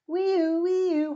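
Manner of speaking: high, loud siren wee-oo; loud siren wail. Acted. Little truck racing out, loud siren. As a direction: blare it like a siren.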